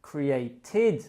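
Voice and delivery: slow "created", cre-ay-TID; teacher's model of "created" ending in id. Shown as a demonstration. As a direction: split "created" into clear syllables. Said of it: "but the sound is a T".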